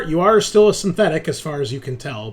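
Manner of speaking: normal talking voice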